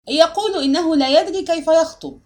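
high, loud jeering relay; mocking shout. A narrator's reading. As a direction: shout it across the hall to mock him. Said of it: "صائحا بلهجة ساخرة".

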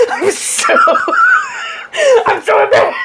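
high shrieking laugh through the words; laughing quote. Retold or imitated. Retelling husband's embarrassment, laughing shriek. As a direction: burst out laughing mid-quote. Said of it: "My husband's like".

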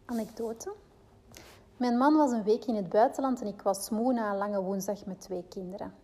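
ordinary talk, nothing marked

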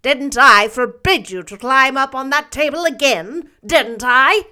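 loud, sharp rebuke; stern scolding. A narrator's reading. Marilla scolds Davy for the ruined pies, loud and stern. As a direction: demand an answer from the naughty boy.

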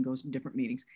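normal talking voice

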